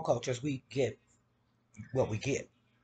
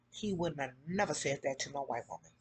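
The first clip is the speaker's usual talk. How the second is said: softer, lower under-breath remark; muttered aside; 6 semitones lower, 9 dB quieter than usual. Shown as a demonstration. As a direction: say it low to yourself first. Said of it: "repeated louder".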